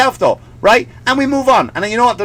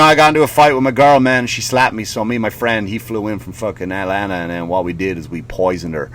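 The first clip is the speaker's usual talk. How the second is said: imitating [name]; 9 semitones lower than usual. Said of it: "[name] does that same song".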